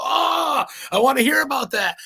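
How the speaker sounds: "Oh" shot way up, then loud and eager; the host hearing a demon story, suddenly thrilled; excited interest. Retold or imitated. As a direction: leap in with delighted curiosity. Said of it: "I go".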